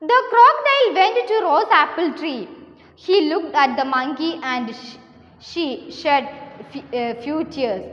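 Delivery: slow, deliberate classroom story reading; story read-aloud. A narrator's reading. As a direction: read it out slowly, phrase by phrase. (സാവധാനം കഥ വായിച്ചു കേൾപ്പിക്കുന്നു)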